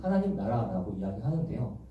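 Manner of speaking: normal talking voice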